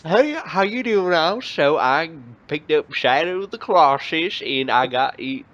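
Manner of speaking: slow, drawn-out mock southern accent. Retelling his video intro to friends, in a slow mock southern accent. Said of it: "Like, I was just talking like that".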